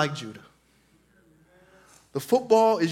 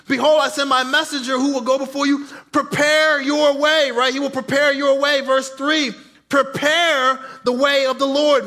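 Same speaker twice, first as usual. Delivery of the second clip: fervent sermon voice, raised and high; preaching; 6 semitones higher, 4 dB louder than usual. Acted. Pastor preaching to the church, fervent. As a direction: preach it out to the congregation.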